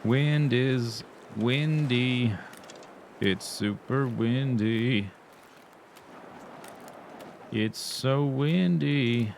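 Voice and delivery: very slow, drawn-out words; slow drawl. Pretend. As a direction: stretch every word out playfully. Drawling about the wind.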